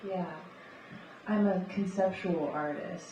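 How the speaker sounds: normal talking voice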